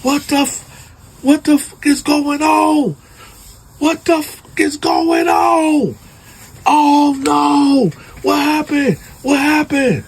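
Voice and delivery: high frantic screaming in shock; anguished scream. Retold or imitated. Man at the crime scene screaming in horror. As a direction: scream it out like your family's just been found dead. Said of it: "He loses it".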